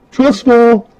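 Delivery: high, loud playful holler; mock call-out. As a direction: call it out as if about to fall backwards. Acts out calling before falling.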